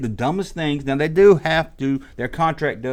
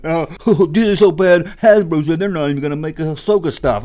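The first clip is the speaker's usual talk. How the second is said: loud, higher sneering mock of a Disney hater; 4 semitones higher, 5 dB louder than usual. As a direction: whine it like an online hater. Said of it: "hate website, YouTube channel's gotta run with it".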